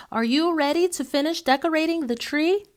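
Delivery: grandma calling down to her grandson. A narrator's reading. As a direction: keep calling up the stairs. Grandmother calls from upstairs.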